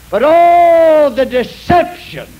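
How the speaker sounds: loud, high, drawn-out lament; anguished cry. Acted. Preacher lamenting to the congregation, loud drawn cry. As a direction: cry it out over the deception.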